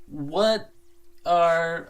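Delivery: ordinary talk, nothing marked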